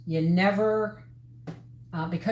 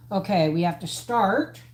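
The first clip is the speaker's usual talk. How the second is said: ordinary delivery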